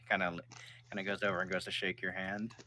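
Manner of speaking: ordinary talk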